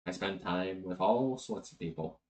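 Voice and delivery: soft, measured, earnest speech; hushed, grave immortal confiding a lesson; solemn archangel. Acted. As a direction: explain a sacred duty gently, with pauses.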